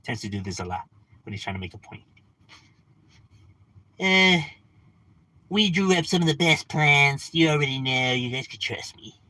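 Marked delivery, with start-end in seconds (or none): 4.0-9.1 Trump impression. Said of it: "how he talks".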